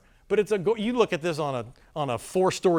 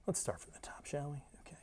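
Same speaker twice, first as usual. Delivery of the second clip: unvoiced whisper to himself; whispered aside; 17 dB quieter than usual. Real, not acted. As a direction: murmur it under your breath. Quiet aside while restarting clip.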